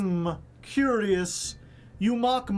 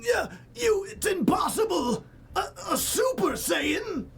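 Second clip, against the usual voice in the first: high, frantic disbelieving shout; shocked villain; 10 semitones higher than usual. Retold or imitated. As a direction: scream in panicked denial like a beaten villain. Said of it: "Frieza's like".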